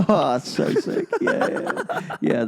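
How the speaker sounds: high delighted laughter; laughing. Real, not acted. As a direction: giggle through the words. Delighted at robot joke.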